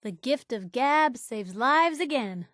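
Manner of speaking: ordinary delivery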